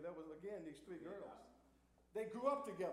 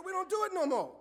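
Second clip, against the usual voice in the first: "no more" thrown out much higher and louder; imitating Reverend Curtis; 12 semitones higher, 8 dB louder than usual. Preacher quoting Reverend Curtis to the church, loud high call. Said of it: "He didn't say more".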